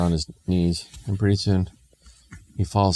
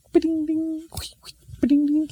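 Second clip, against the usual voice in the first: very high ringing ba-ding; ping it out like a tiny coin bouncing; imitated coin clinks. Imp flips more coins, high imitated clinks.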